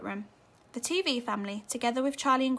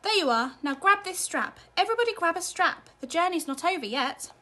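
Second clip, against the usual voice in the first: raised, higher call over the noise; urgent raised command; 7 semitones higher, 5 dB louder than usual. A narrator's reading. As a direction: shout the order so all can hear. Wonka calling over the lurching lift.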